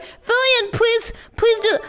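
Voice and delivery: high drawn-out whiny plea; mock begging viewer. Streamer imitating a begging viewer, slow whiny plea. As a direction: beg it slowly and whiny. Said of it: "to be like".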